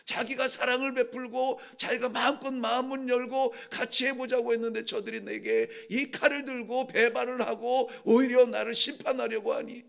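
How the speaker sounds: normal talking voice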